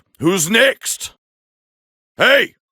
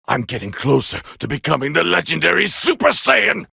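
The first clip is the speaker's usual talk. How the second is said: lower, steady menacing boast; cold taunt; 6 semitones lower than usual. Acted. Saiyan prince coldly taunting his opponent, low and menacing. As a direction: talk down to the enemy, low and sure.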